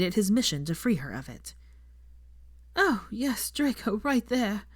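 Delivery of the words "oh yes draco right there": high, slow, breathless gasps of pleasure; gasping. A narrator's reading. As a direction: pant the words out. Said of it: "she said, gasping".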